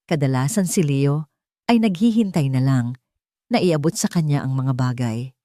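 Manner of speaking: ordinary delivery, nothing marked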